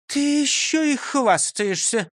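high, slow indignant teacher. Acted. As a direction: tut at his bragging. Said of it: "возмутилась Фрёкинсталь". (возмущённо)

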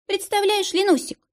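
high sweet coo. A narrator's reading. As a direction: coo it to a friend. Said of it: "проворковала Дарья". (воркующим голосом)

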